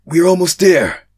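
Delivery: urgent call. Acted. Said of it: "said one of them. It made a male's voice".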